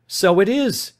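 loud, high alarmed cry; sudden loud high cry; cried out. A narrator's reading. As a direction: burst out in shock. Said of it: "he cried".

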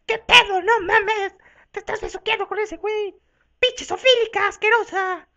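high, loud outraged voice; outraged shriek. Acted. Monkey catching her rival kissing that guy, outraged shriek. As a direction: shriek in disgusted disbelief.

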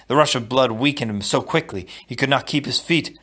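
plain reading voice

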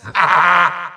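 brief gloating cackle; short villainous "ha ha"; evil laugh. Acted. Villain sends all three minions at once, gloating laugh.

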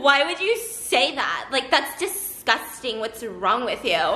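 slow, mock mean-girl scolding; disgusted scolding. Friend scolding the little sister, slow mock disgust. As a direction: draw out each word in imitated disdain.

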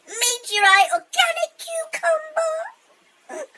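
slow, squeaky high-pitched wail; helium voice. Real, not acted. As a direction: stretch out random words like a chipmunk. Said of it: "Tourette's on helium".

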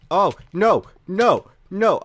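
loud, high yelps of no; panicked cries. Real, not acted. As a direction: burst out in alarm as you're about to die. About to die in game.

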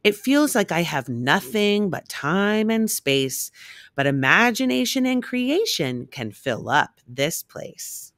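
plain reading voice

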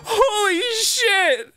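high, slow exclamation; astonished cry. Real, not acted. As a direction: stretch it out in shock. Stunned by the play.

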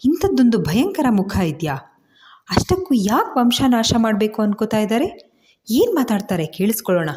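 stunned eavesdropper thinking aloud; shocked heroine. Acted. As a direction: voice her disbelief, pitch jumping up on questions. Heroine's shocked thoughts while eavesdropping.

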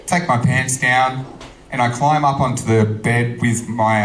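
ordinary delivery

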